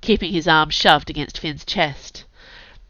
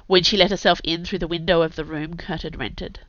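plain reading voice

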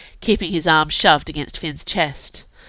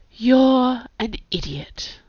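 high, slow, drawn-out reproach; scolding; 7 semitones higher, much slower than usual. A narrator's reading. Inga climbing in at Kurt's window, slow scolding. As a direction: draw it out as a withering scold.

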